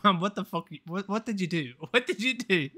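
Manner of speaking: half-laughing "what did you do"; retold shock at the mic dissolving into laughter; laughing mock outrage. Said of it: "I was like".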